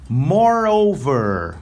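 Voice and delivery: Moreover said very slowly and clearly; slow model word. Shown as a demonstration. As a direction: stretch Moreover out for learners. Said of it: "É juntinho".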